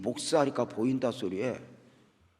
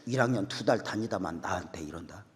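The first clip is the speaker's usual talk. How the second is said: usual speaking voice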